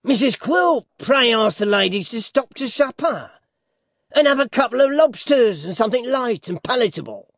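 high-pitched dwarf character voice; high, theatrical hosting; domineering dwarf, falsely hospitable. A narrator's reading. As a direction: order it with sly, showy politeness. Voices dwarf Quilp in reading.

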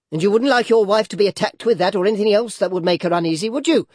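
high, rapid, loud challenge; fast, affronted mother-in-law; indignant old lady. A narrator's reading. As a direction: rattle it off at him, pitching up at the end. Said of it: "said Missus Ginowin".

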